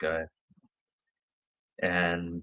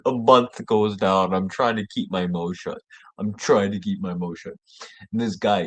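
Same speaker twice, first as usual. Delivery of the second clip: usual speaking voice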